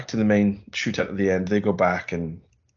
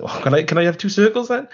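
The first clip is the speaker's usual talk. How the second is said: mock hopeful ask; 8 semitones higher, 4 dB louder than usual. Mock bargaining for other shapes.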